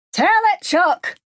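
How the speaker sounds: high, loud shout from the crowd; ringing call. A narrator's reading. Fan cheers Chuck on from the crowd, high ringing shout. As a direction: ring it out to the preacher. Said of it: "a voice rings out".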